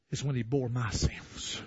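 hushed reverent sermon voice. Real, not acted. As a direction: drop to a near whisper.

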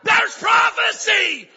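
high, drawn-out preacher's cry; shouted proclamation. Real, not acted. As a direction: announce it at full force. Preacher bursts out announcing prophecy.

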